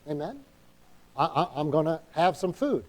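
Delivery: normal talking voice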